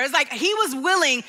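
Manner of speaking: ordinary talk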